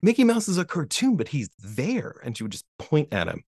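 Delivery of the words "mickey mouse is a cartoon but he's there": put-on high, wide-eyed toddler wonder; amazed disbelief. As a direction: gasp it out like a stunned kid. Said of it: "this disbelief".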